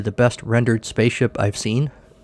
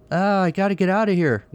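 mock scream; 9 semitones higher than usual. A narrator's reading. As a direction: play a storybook scream without the volume. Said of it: "Gordon screamed".

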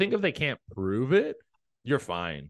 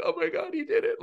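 wife crying; faster than usual. Retold or imitated. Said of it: "She's like".

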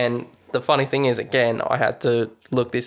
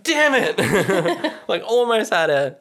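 high, laughing damn it; playful disappointment; host voices Rod missing his chance, laughing; mock frustration; 8 semitones higher, slower than usual.

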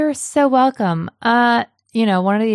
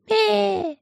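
high squeaky dragon bleat; baby dragon; 13 semitones higher than usual. Retold or imitated. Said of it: "if they've kept the same voices".

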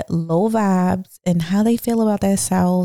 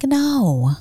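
exasperated outburst; 6 semitones higher than usual. Retold or imitated. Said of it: "I just couldn't hold it in".

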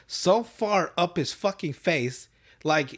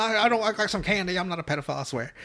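put-on old man voice; 6 semitones higher than usual. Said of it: "Sounds like an old man".

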